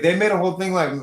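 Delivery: normal talking voice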